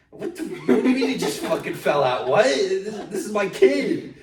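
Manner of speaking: high, loud imitation of furious parents; hammed-up shouting in protective outrage; outraged parents. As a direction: yell it like a parent losing it. Parents outraged at nurse's mistake, retold.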